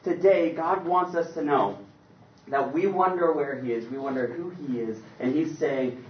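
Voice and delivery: usual speaking voice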